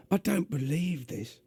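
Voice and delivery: gruff, high London demon voice; exasperated demon in a gruff London accent. Acted. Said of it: "doing a gruff, uh, London accent".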